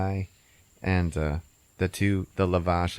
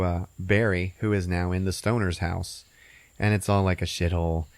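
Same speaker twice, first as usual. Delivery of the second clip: ordinary delivery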